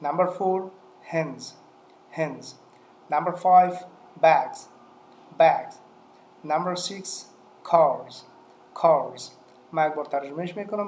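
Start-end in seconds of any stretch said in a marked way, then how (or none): 1.1-2.4 "hens" with the final s voiced as z. Shown as a demonstration. 4.2-5.7 "bags" with the final s voiced as z. Shown as a demonstration. 7.7-9.3 "cars" with the final s voiced as z. Shown as a demonstration.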